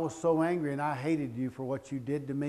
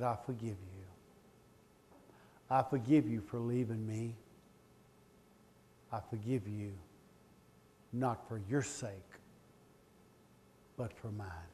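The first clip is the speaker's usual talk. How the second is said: tender forgiveness; 3 semitones lower, 5 dB quieter than usual. Retold or imitated. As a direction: let go gently. Forgiving ex-wife in empty chair.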